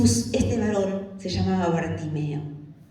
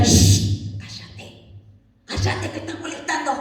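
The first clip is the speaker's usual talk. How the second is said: sharp shush, then hushed scolding; hushing rebuke; 7 semitones higher than usual. Acted. Crowd rebuking the blind man to be silent, hushed scolding. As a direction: shush them in a whisper rising in pitch. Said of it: "Le reprendían para que callase".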